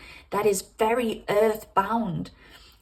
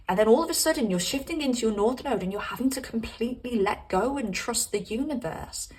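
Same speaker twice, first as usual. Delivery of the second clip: ordinary delivery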